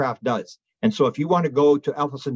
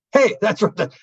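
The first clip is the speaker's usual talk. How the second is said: high, louder burst of recognition; excited realization; 9 semitones higher, 4 dB louder than usual. Real, not acted. Telling James the colonel said it too, high excited burst. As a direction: jump in eagerly, struck by it. Sudden realization during interview.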